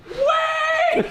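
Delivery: a sudden very high, laughing yell; mock shout. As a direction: yell it out mid-laugh, hammed-up. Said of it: "And then she goes".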